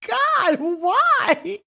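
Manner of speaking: high, stretched mock dismay; hammed-up squeal of astonishment; dramatic disbelief. Telling best friend over the phone, mock-dramatic disbelief. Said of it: "And I'm like".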